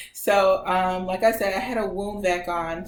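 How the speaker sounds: ordinary talk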